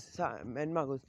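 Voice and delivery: ordinary delivery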